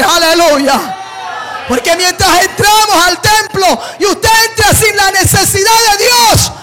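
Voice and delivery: loud, high shouted preaching; preaching at full force. Acted. Preacher urging the congregation to come hungry, shouted fervent preaching. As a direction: build it into a fiery climax.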